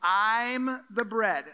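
slow, loud insistence; emphatic. Acted. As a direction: spell it out slowly and forcefully. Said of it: "it's like, focus, focus".

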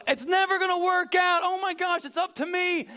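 high, frantic imitation of someone overwhelmed; fretful panic. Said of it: "you don't go".

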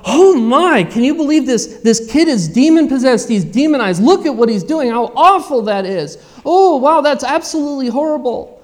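loud, high-swooping exclamations of dismay; mock horror. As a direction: act out a scandalized onlooker, hammed-up. Mimics congregation's shocked reaction to the verse.

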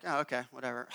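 quiet, deflated "oh okay whatever"; resigned. Retold or imitated. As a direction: shrug it off, half annoyed. Mock resigned reply to store.

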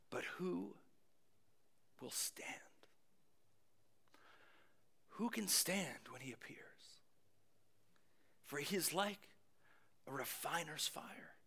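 hushed, near-whispered question; haunting voice. Retold or imitated. Preacher voices Malachi's answer to the booming voice, haunting hush. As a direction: drop to an awed, haunted hush with long pauses. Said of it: "and haunting voice".

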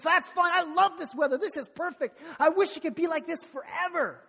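high, loud mock delight; hammed-up sarcastic insistence. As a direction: overdo the approval like a sulking kid. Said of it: "Kinda, kinda sarcastic".

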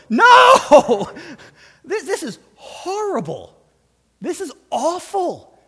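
high, loud appalled cry; shocked outcry. Pretend. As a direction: cry out as if reading it for the first time. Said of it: "we should all just go".

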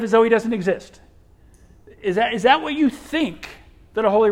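loud, high, urgent preaching; preaching at full force. Acted. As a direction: press the point on the congregation.

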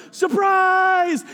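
high, drawn-out group-style shout; party yell. Retold or imitated. Party guests yelling as Agnes walks in, joyful shout. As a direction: yell it as a big surprise greeting. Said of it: "and they yell".

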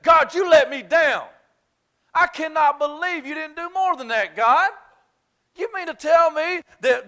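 high, loud frustrated rant at God; acted angry complaint. Elijah scolding God, high and exasperated. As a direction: rail at God in disbelief.

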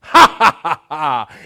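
a dad's unbothered belly laugh; hearty laugh. Retold or imitated. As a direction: laugh off a tiny attack. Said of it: "And I laugh".

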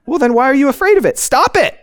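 fast, high, loud scolding as the therapist; mock exasperated yell. Therapist scolds woman afraid of being buried alive, exasperated yell. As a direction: rush the question, then yell the order high. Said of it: "is what he's saying".

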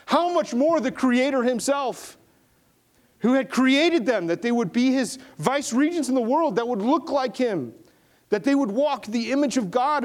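high-pitched, fervent sermon delivery; impassioned preaching. Real, not acted. Preaches at full force.

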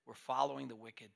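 usual speaking voice

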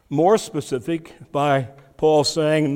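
ordinary talk

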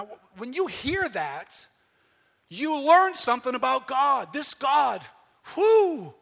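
loud, high, fiery sermon delivery; full-force preaching. Acted.

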